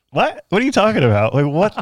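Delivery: high, loud incredulous "what?"; retold disbelief. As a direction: replay the shock of the freight delivery. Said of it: "I was just, like, blown away".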